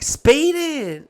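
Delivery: mock girly reply; high mock voice. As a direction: squeak it like the gossiping friend. Clerk's friend's reply retold.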